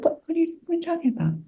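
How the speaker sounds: high, hushed and disbelieving; a small, high "what are you talking about"; startled denial. Retold or imitated. Brushing off her colleague's concern, high and hushed. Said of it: "and I said".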